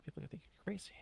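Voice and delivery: hushed, whispered confiding; conspiratorial whisper. Acted. Confiding about talking to oneself.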